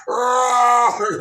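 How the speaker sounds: high loud yelp; hammed-up startled cry; startled shout. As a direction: yell out in shock. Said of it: "Tim Dillon's like".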